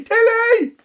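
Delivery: shouting the names high across the water; calling out. Acted. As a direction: call to the twins from the beach. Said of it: "He called out to Tilly and Lily".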